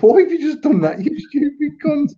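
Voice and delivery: high-pitched incredulous retort, acted out; mock outraged disbelief. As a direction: retell it as a high, appalled telling-off. Retelling his shock at pals' prank.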